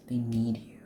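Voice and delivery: breathy whispered emphasis. Real, not acted. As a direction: drop to a whisper to drive it home. Whispered aside in reading.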